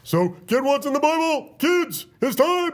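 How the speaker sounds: hammed-up children's TV announcer; Buck Denver voice. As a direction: pitch it like a cheery puppet newsman for kids. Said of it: "That was almost Buck Denver".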